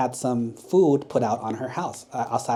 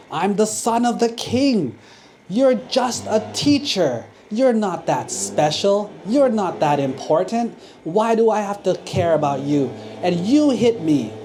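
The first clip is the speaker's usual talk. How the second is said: higher, louder furious rant; angry prince; 7 semitones higher, 5 dB louder than usual. Acted. Hit prince rages at the teacher, high and loud. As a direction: climb into an outraged tirade. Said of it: "was so angry".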